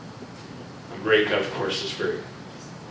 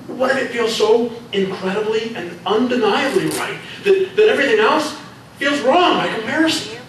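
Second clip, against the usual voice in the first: much higher, rising passion; impassioned plea; 10 semitones higher than usual. Acted. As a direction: pour it all out, swept away.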